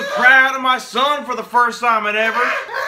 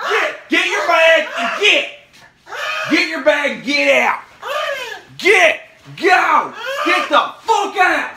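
mock furious yelling; 6 semitones higher than usual. Dad shouting at his grown son to get out, in hammed-up fury. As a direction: bellow him out the door in pretend rage.

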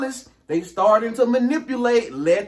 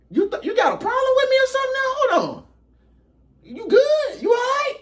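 much higher, fast, mock offended; high defensive friend. Friend asking what the problem is, in a high, offended voice. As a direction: squeak out a wounded, offended friend.

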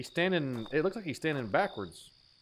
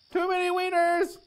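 laughing squeal; 13 semitones higher, 4 dB louder than usual. Real, not acted. Laughing reaction to streamer joke.

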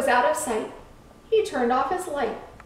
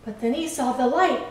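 usual speaking voice

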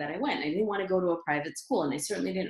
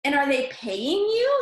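higher, louder, slow disbelief; astonished mom; 8 semitones higher, 5 dB louder than usual. Retold or imitated. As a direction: ask it as if it's impossible. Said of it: "she was in shock".

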